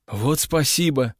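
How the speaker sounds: dry, mocking thank-you; sarcastic little smirk. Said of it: "саркастически усмехнулся я".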